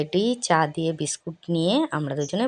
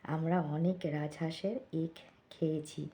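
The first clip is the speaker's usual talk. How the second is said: hushed talking; 9 dB quieter than usual. Real, not acted. Vlogger saying good night to viewers while kaka sleeps next door, hushed. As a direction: keep it very soft, someone's sleeping next door. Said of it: "এই জন্য আস্তে আস্তে কথা বলছি".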